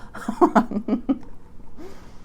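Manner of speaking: little self-conscious chuckle; short laugh. Real, not acted. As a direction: laugh it off lightly. Laughs at her own stuttering.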